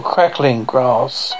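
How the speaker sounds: ordinary delivery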